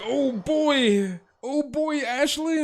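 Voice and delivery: thirsty guys. Retold or imitated. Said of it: "They're like".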